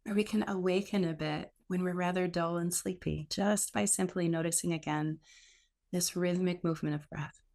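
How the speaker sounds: a gentle guide settling the class into stillness; guided meditation. Acted. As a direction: ease listeners into the breath, unhurried.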